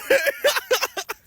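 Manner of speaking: short high burst of laughter; real laugh. Real, not acted. Storyteller amused by their plea, laughing high. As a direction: crack up at your own line.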